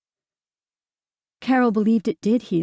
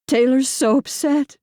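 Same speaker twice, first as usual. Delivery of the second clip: through tears; 6 semitones higher, 5 dB louder than usual. A narrator's reading. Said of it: "Carol said through her tears".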